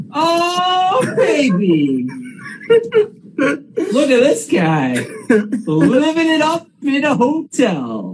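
high, hollered hype welcome; excited greeting. Real, not acted. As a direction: whoop it up for a friend, stretching the words out. Host hails friend joining the live.

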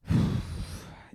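long acted-out sigh; heavy exhale before asking; weary sigh. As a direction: let out a reluctant breath. Sighs before asking man to mask.

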